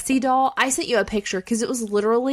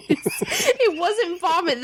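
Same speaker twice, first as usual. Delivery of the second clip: laughing; 7 semitones higher, much slower than usual. Real, not acted. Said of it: "laughing relief".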